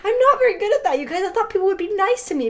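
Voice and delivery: high and loud, quick self-pitying plea; hammed-up wounded complaint, fast; whiny protest. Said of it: "I was like".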